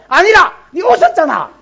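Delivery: loud, high contradiction; acted quarrelsome retort; arguing back. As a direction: insist sharply that she did it. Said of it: "하나님하고 사라 사이에 싸우는 거예요".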